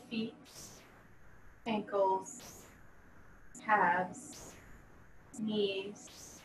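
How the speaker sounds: slow, measured yoga nidra voice with long pauses; guided meditation. Acted. Yoga teacher leading students through a body scan, calm guided meditation. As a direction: guide them calmly, letting each body part settle.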